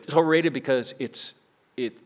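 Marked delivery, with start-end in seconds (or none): none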